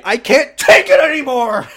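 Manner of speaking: high, loud mock cry of despair; hammed-up outburst. Mocking Ben throwing down his apron, high loud melodrama. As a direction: fling it out melodramatically. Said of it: "so that I can say".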